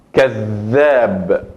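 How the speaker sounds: كذاب slowly, the ذ doubled with force; stronger doubled dhal. Shown as a demonstration. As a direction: hit the ذ of كذاب with emphasis. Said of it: "la pronuncio con más fuerte y más énfasis". (كذاب con más fuerza en la ذ)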